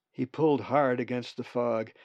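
ordinary delivery

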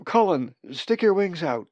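high, loud warning call; urgent cry. A narrator's reading. Molly to falling Colin, urgent shout. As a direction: call out to save a falling friend. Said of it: "she cried".

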